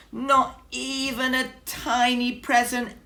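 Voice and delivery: high, loud squeaky complaint; high mouse squeak, let down. Acted. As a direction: squeak out the disappointment. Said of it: "squeaked Mouse".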